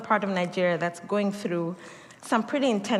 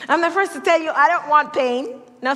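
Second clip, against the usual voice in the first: higher and louder confession; a louder, higher-pitched laughing admission; playful loud protest; 5 semitones higher, 6 dB louder than usual. Real, not acted. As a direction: insist with comic alarm. Joking admission to congregation.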